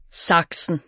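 plain reading voice

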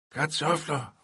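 high shouted answer. Retold or imitated. Children shouting the story's answer to uncle, high and loud. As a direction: call it out together, high. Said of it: "råbte vi alle sammen".

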